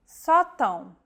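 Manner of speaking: sótão stressed on só with a nasal ending; sótão, open stressed O; Portuguese stress demo; stress on first syllable. Shown as a demonstration. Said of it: "The stressed vowel is marked by an acute".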